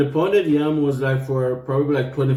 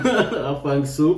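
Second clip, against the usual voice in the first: ordinary talk, nothing marked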